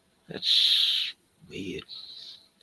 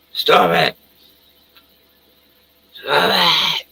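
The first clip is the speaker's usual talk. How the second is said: loud, higher mock robot shout; yelling computer; 6 semitones higher, 7 dB louder, slower than usual. Streamer voicing his nagging computer, loud mock yell. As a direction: shout it like an angry machine. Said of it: "it's yelling at me in".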